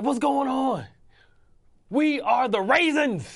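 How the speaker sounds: high, loud mock coach voice, slow on the raisins line; hammed-up fired-up coach at halftime; players' coach shouting a botched pep talk; imitating coach Singletary. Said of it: "He's like".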